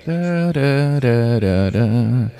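ordinary talk, nothing marked

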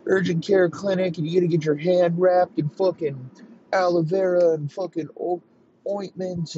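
high-pitched ranting wounded man; mock screaming rant. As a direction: act out a hammed-up, high complaint about an injury. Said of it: "he's screaming about his fucking hand".

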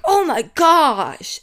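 loud, high, drawn-out mock horror; hammed-up slow dismay; exaggerated shock. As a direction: parody your own aghast reaction. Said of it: "my transitions are so bad".

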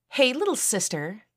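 high-pitched, sing-out taunt; mocking greeting, pitched well up. A narrator's reading. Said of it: "taunted Elizabeth".

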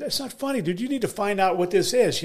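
high-pitched, exasperated protest; retold angry complaint. Comic retelling how he scolded his laughing agent, high and exasperated. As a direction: pitch it way up, as if the agent had betrayed him.

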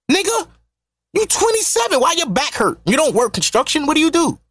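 you're twenty-seven, come on, said high and amazed; incredulous. Acted. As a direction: voice leaps way up in disbelief. Incredulous at 27-year-old's back pain.

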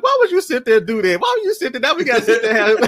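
high, loud exasperated complaint; imitated scolding dad. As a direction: play an exasperated dad telling off mom.

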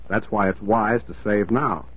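ordinary delivery

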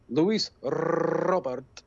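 Robert with a trilled double R; rolled r. Pretend. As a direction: roll the R in Robert hard. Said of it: "Oh, rolling the R's".

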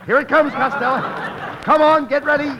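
high, loud warning; urgent call. Acted. Castaway warns his partner of the big wave, urgent shout. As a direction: call out over the surf.

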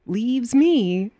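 ordinary talk, nothing marked

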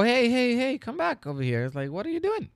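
high surprised call after someone; mock alarm, calling the kid back. Teacher retelling how he called after the student taking the gift back, high mock surprise.

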